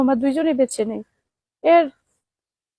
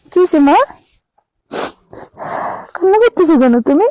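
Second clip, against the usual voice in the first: raised, louder voice over the crying; 3 semitones higher, 5 dB louder than usual. Real, not acted. Comforting crying children, calling out.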